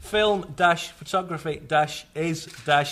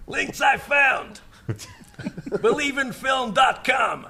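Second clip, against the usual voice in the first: high, throat-straining mock shout; Michael Russo style; 8 semitones higher than usual. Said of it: "it hurts my throat".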